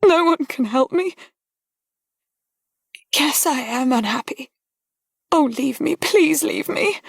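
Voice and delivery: begging him to go through tears; high, breaking, tearful young woman; tearful plea. A narrator's reading. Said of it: "A deep sob shook her".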